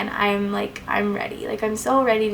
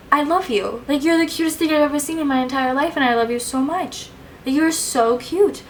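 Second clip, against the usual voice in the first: high, choked-up adoration; near-tears gushing; 6 semitones higher than usual. Retold or imitated. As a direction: gush lovingly as if about to cry. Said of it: "like, crying right now".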